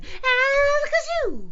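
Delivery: very high, loud, stretched-out incantation; shouted spell. A narrator's reading. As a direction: wail the magic word slowly. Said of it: "Keith tried again".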